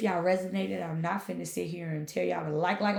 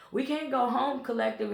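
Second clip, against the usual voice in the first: ordinary talk, nothing marked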